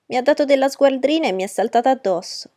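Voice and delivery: put-on high, loud childish whine; whining. A narrator's reading. Punched boy telling on his cousin, whining. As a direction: whimper out an aggrieved accusation. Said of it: "piagnuccolò lui".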